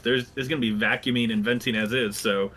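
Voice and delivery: normal talking voice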